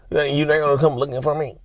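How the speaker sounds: a touch of mock pleading; mock hurt complaint. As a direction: lightly mimic an offended partner. Acting out the narcissist's complaint.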